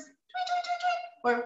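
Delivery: high, soft tweeting; bird tweets. Shown as a demonstration. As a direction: chirp like a little bird. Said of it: "So birds".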